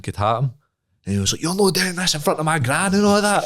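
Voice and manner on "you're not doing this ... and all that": higher, whining protest; mock whiny guy. The guy in Asda pleading, higher and whiny. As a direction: whine it like a scared guy.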